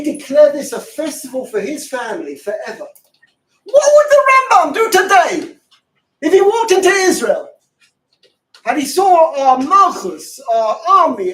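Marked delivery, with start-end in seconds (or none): 3.6-11.3 high, loud rhetorical demand; preacher's outburst at full voice; impassioned shout. Real, not acted. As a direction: thunder the question at the audience. Preacher's impassioned climax question.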